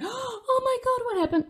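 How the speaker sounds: high, loud mock panic; alarmed parent. Parent sees the kid fall, high mock panic. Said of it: "If the parent is like".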